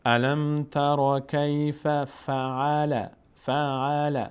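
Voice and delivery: الم تر کیف فعل chanted slowly; slow recitation. Shown as a demonstration. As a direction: recite slowly, joining the sukun. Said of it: "ساکن میچسبه به ما قبلش". (تلاوت آهسته)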